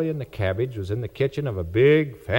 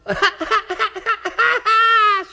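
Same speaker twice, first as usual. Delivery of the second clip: put-on very high child's giggle; high girlish giggle; 4 dB louder than usual. A narrator's reading. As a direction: giggle like a delighted little girl. Little girl giggles.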